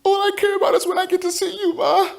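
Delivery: put-on a child longing for his mom; young boy voice. Child on phone with mother.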